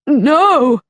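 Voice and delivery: a hammed-up storybook scream. A narrator's reading. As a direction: cry out as she falls. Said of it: "she screamed".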